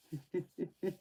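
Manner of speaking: quiet, high little giggle; soft chuckle. Real, not acted. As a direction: chuckle softly to yourself. Amused at own accent.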